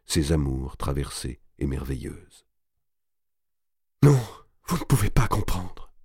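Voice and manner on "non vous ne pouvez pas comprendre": wounded moan. A narrator's reading. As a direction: moan it out in grief. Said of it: "gémissait-elle". (gémissement douloureux)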